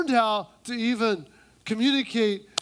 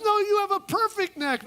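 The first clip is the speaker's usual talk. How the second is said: very high flattery; hammed-up sweet friend; gushing woman; 11 semitones higher than usual. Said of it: "Woman number one says".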